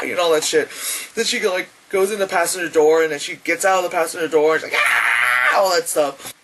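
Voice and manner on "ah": loud harsh mock scream; sudden acted screech; imitated scream.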